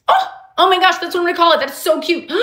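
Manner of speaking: high, excited squeal of discovery; light up with a laugh at a cute new idea; real high-pitched delight, ending in a gasp; delighted realization. Real, not acted. Delighted at finding a show name.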